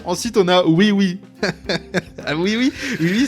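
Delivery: normal talking voice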